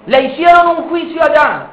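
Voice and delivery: normal talking voice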